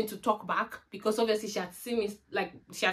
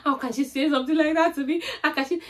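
retold bawling; 7 semitones higher, 5 dB louder than usual. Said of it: "I started bawling on the phone".

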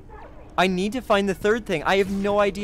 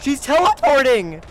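higher and louder in alarm; alarmed shout; 8 semitones higher, 7 dB louder than usual. Real, not acted. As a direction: shout the warning in panic. Repeats in alarm.

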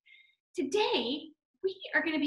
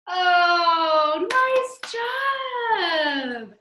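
high, loud, sing-song cheer; big drawn-out congratulation; excited praise; 4 semitones higher, 7 dB louder, much slower than usual. Real, not acted. Cheering the children on.

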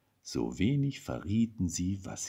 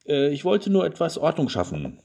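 higher, louder, caught-out wife; embarrassed excuse; 8 semitones higher, 5 dB louder than usual. A narrator's reading. Wife caught searching the wardrobe, embarrassed excuse. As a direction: cover up with a flustered little excuse.

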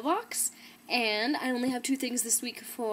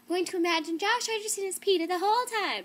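higher, lightly voiced gushing mother; a hint of a giddy fan mom; imitating excited mom; 9 semitones higher, 3 dB louder than usual. Retelling her mother's plan for the audiobook, lightly imitated and higher. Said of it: "She's like".

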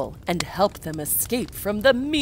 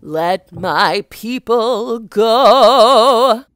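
louder, slow, stretched proclamation; booming command; 4 semitones higher, 12 dB louder, slower than usual. Acted. Moses commanding Pharaoh, booming and slow. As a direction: demand it like a prophet.

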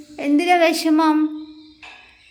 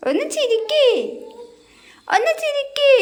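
much higher, squeaky coaxing voice; squeaky baby coaxing. Acted. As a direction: squeak up high to win a smile.

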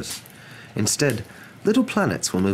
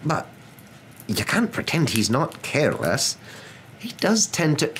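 reluctant, drawn-out objection; grudging; 10 semitones higher than usual. A narrator's reading. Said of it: "said Professor McGonagall grudgingly".

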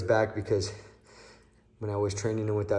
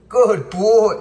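higher, much louder squeaky praise; squeaky praising a dog; 10 semitones higher, 13 dB louder than usual. Real, not acted.

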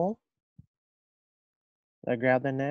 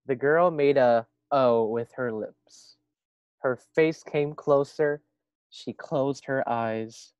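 lively storybook reading voice; reading to children; 2 semitones higher, 7 dB louder, slower than usual. A narrator's reading. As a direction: read it aloud to a class. Reads story aloud to first graders.

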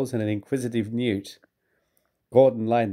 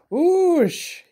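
high, sharp engine hiss of alarm; high steamy hiss; 4 dB louder than usual. A narrator's reading. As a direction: let out a shrill puff of steam. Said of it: "he hissed".

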